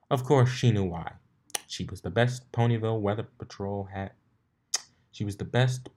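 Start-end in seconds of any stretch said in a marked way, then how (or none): none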